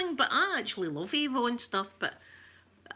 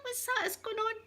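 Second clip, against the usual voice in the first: baffled; 14 semitones higher, faster than usual. Retold or imitated. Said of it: "But I was like".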